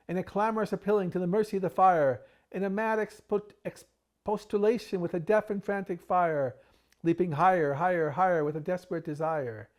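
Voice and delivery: breathless panic over the fire; frantic. Acted. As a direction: rush it like a frantic fire alarm.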